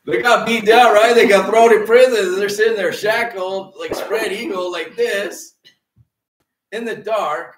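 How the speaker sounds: preaching at full force. Acted. Pastor crying out to his congregation, loud preaching. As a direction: build it up and hammer the point home.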